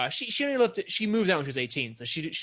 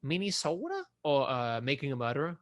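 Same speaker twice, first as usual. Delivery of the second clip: usual speaking voice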